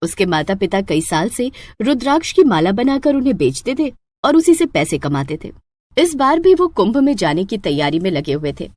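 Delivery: plain reading voice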